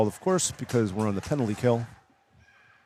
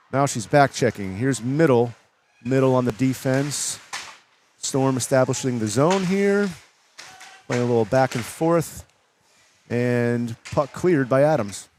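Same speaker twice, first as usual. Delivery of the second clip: play-by-play commentary; 2 semitones higher than usual. Real, not acted. Live play-by-play call.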